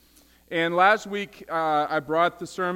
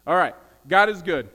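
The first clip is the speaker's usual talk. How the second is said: ordinary talk, nothing marked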